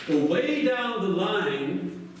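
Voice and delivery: ordinary delivery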